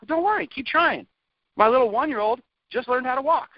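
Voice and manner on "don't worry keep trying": soothing parent. Pretend. Soothing the imagined baby.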